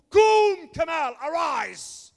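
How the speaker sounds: slow, high, booming command to rise; God's shout to the man on his knees. Retold or imitated. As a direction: call him up from the dead. God commands him to rise.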